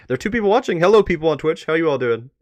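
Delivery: bright, loud, high hello to the chat; excited greeting. Real, not acted. Streamer greeting the two Twitch viewers, excited and loud.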